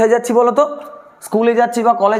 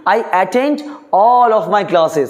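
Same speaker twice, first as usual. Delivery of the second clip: slow dictation; much slower than usual. Shown as a demonstration. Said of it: "teacher dictating".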